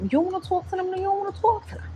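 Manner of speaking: high-pitched playful New York accent attempt. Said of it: "my accent might be fucked up".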